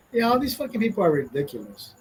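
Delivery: usual speaking voice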